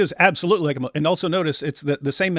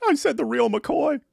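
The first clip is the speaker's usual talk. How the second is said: high, drawn-out mock outburst; exaggerated high-pitched impression; Sam; 10 semitones higher, slower than usual. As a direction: send him up in a high, slow voice. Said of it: "That's Sam".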